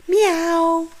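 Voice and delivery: high kitten meow. A narrator's reading. Narrator mewing as a kitten for the listeners. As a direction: mew like a little kitten.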